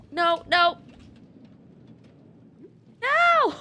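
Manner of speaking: loud nos rising to a shriek; panicked yelling. Real, not acted. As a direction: yell in mounting frustration. Attacked in game.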